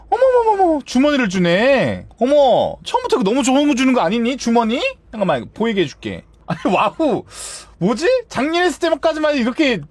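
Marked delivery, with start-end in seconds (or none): 0.1-4.8 high, fussing amazement; delighted surprise. Real, not acted. Player getting a bag as early loot, delighted surprise. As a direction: exclaim over an unexpected gift.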